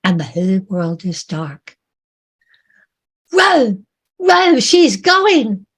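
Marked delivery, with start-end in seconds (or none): 3.3-5.7 high, loud, slow shout of alarm; panicked shout. Acted. Sailor yelling at rowers as the ship sinks, loud panic. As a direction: scream that the ship is going.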